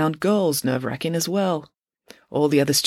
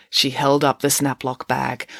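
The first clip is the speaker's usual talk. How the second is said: plain reading voice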